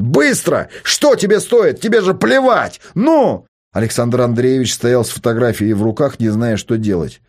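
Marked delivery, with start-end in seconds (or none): 0.0-3.5 higher, louder goading shouts; yelling. A narrator's reading. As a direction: push the father hard, yelling. Son keeps yelling at father. (настойчивый крик)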